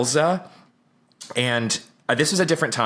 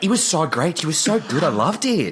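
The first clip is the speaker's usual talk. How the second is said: put-on higher-pitched, gushing Australian accent; 5 semitones higher than usual. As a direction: imitate excited Australians handing out praise. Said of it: "they're actually Australian".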